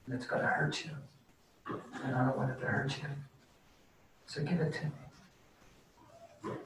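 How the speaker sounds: the Lord gently whispering to anxious listeners; tender whisper. Acted. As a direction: breathe it out like a loving father. The Lord's voice pleading gently.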